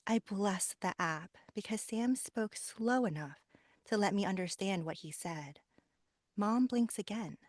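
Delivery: audiobook reading with character voices; story narration. Shown as a demonstration. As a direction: read it like an audiobook. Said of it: "so I'll just do a quick, very quick little blurb".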